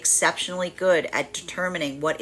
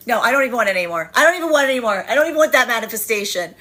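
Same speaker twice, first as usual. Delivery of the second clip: petulant; 5 semitones higher, 6 dB louder, faster than usual. Pretend. Petulant rejection quote.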